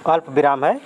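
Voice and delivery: ordinary talk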